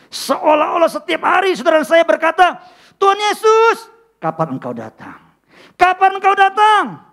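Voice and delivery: high, loud pleading prayer; fervent cry. Acted. Preacher crying out for Jesus to come, loud.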